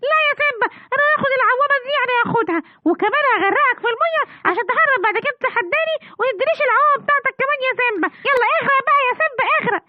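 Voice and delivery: put-on high, taunting child voice; a mean little boy taunts his friend while dunking him; bratty bully kid. Bully child steals float, drowns friend.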